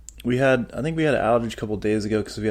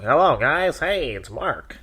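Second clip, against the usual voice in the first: groggy just-woke-up voice, a bit higher; nasal voice; 4 semitones higher than usual. Shown as a demonstration. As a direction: show off a stuffy morning voice. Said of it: "sometimes I just wake up and I'm just like".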